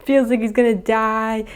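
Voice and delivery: ordinary talk, nothing marked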